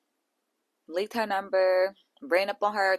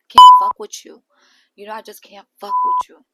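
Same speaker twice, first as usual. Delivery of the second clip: Natalie's line shouted, much higher and louder; imitated outburst; 16 dB louder than usual. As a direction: snap at the end of the speech. Natalie's confrontation, voiced by host.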